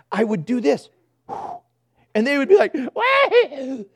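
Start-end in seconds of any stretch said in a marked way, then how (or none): 2.1-3.8 imitated baby gasp. Baby gasping reaction.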